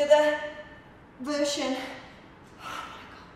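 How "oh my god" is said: whispered gasp of strain; barely voiced from effort; breathless whisper. Real, not acted. Straining during bicycle abs.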